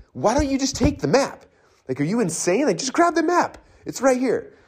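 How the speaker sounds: very high-pitched, incredulous pleading; exasperated. Acted. Coach to someone lost who refuses a map, exasperated. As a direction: plead in mock disbelief, voice shooting up.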